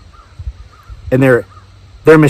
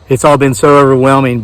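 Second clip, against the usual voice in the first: ordinary talk, nothing marked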